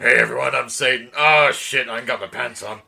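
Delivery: loud, booming devil character; Satan voice. Pretend. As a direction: play a brash, pantsless Satan. Voicing Satan on a poster.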